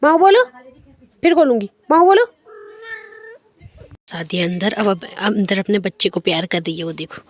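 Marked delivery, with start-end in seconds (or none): 0.0-2.2 high, loud coaxing pet talk; high sing-song coaxing of the cat. Real, not acted. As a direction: coax the cat to say meow.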